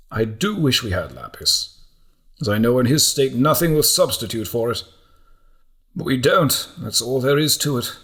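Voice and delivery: firm but regretful mentor; lightly voiced elder accepting a loss; resigned old monk. Alcuin resigned about the lapis.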